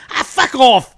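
put-on loud, high Irish-accented dismissal; Irish bartender. Irish bartender answering a customer asking the price, Irish accent. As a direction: throw it out as an Irish barman's brush-off. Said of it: "Irish bartenders".

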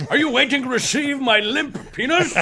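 high, grand orator voice; regal impression. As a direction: ask it with hammed-up regal poise. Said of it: "he's so regal during this whole thing".

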